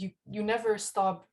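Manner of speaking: ordinary talk, nothing marked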